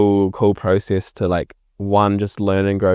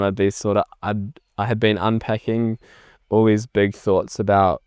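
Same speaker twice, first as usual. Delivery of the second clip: ordinary delivery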